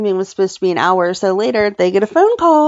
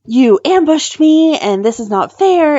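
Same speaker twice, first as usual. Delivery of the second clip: higher, louder, slow indignant outburst; angry protest; 7 semitones higher, 5 dB louder than usual. Retold or imitated. Judge protests the ambush, slow loud angry outburst. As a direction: play the cornered judge lashing out. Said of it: "he gets all angry".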